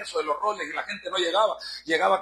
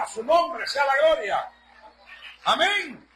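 full-force preaching; 5 semitones higher than usual. Acted. Preacher rousing the congregation to praise, full-force preaching. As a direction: preach it out to the congregation, building to the amen.

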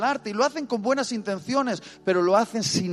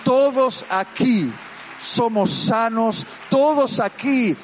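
rousing preaching; 4 semitones higher, 4 dB louder than usual. Acted. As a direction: declare each "todos aquí" like a promise. Preacher proclaims to congregation.

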